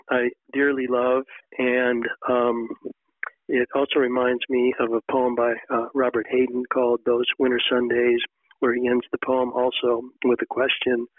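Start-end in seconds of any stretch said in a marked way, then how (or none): none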